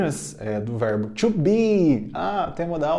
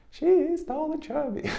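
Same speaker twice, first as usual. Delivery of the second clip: much higher, playful put-on voice; high silly drill voice; 14 semitones higher, 3 dB quieter than usual. Shown as a demonstration. Teacher drills the line in a playfully high voice. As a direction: squeak it up playfully. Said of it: "I'll stop that".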